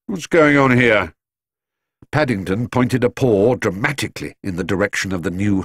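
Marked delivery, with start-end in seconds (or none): 0.1-1.2 slower, louder stern constable; policeman's challenge. A narrator's reading. Policeman arriving at the house questions Paddington, stern and slow. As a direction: demand an answer like a suspicious policeman. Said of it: "said the first of the men".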